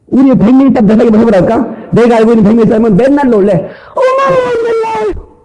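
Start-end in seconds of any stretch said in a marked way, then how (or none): 3.9-5.3 very high, drawn-out squeal of wonder; hammed-up astonished falsetto; exaggerated amazement. Shown as a demonstration. Preacher drilling congregation to sound amazed, high mock squeal. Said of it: "한번 연습해봅시다".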